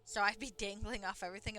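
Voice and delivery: normal talking voice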